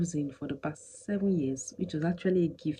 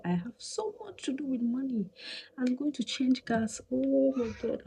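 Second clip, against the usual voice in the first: higher, rising frustration; exasperated rant; 8 semitones higher than usual. Real, not acted. Vlogger recalling the broken cooker, worked up and higher. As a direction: pour out the worry about money. Venting about money.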